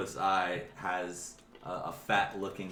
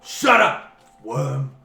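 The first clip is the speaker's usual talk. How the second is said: loud, high-pitched bellow; explosive boss's rebuke; angry ogre shout; 7 semitones higher, 16 dB louder, much slower than usual. Acted. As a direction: roar at a lowly underling to silence them. Ogre boss snaps at goblin.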